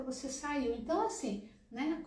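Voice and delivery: ordinary delivery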